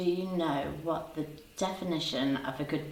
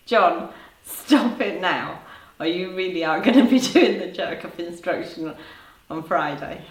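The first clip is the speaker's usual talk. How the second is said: laughing scold; 6 dB louder than usual. Real, not acted. Host telling viewer John off, laughing. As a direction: pretend to scold while cracking up.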